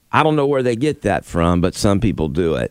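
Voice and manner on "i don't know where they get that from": ordinary talk, nothing marked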